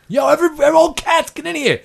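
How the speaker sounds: high, loud shout to gather everyone; hollering cat. Acted. Cat calling all the other cats inside, hollering high and loud. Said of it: "Cats are just like".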